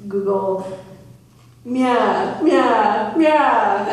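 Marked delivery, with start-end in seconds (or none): 1.6-3.9 loud mock cat meows; meowing. Shown as a demonstration. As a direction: meow like a cat, badly. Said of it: "Gotta work on that".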